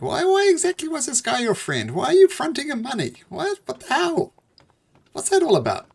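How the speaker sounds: high, insistent string of questions; acted exasperated bewilderment; incredulous questioning. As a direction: pitch it up in baffled disbelief. GM voices incredulous self-questioning.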